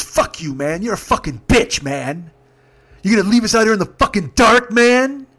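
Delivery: high, loud imitation of an irate passenger; retold angry rant. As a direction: build from insult into outraged yelling. Drunk passenger cursing at driver, retold.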